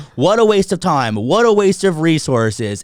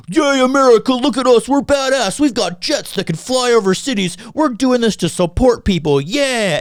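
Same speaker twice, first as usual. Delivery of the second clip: mocking jingoist; 8 semitones higher than usual. Mocking the patriotic crowd, high and gushing. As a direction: ham up a cheering flag-waver. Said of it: "American jerk-offness that's like".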